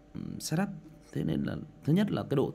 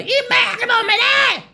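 very high, loud scolding shout; angry mother yelling; 9 dB louder than usual. Retold or imitated. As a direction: yell at the kid to shut up. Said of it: "bà già mày".

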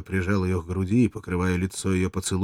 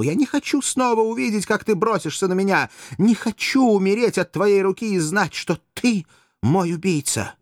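much higher, louder, slowing at the end; fearful pleading; 13 semitones higher, 5 dB louder than usual. Acted. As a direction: plead in fear. Courtesan fears him.

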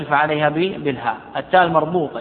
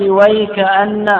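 slow, drawn-out Quranic recitation, pitched higher; recited word; 4 semitones higher, 4 dB louder, much slower than usual. Shown as a demonstration. Teacher reciting the word for students, slow and drawn out. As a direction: chant it slowly like reciting Quran.

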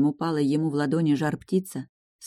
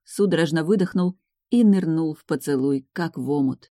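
plain reading voice

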